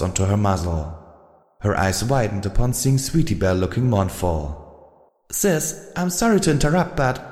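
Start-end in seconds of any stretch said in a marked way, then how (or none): 5.3-7.2 high, bright filly on the phone; chatty little sister; young filly voice. A narrator's reading. Little sister answers a caller, high filly voice. Sweetie Belle speaks.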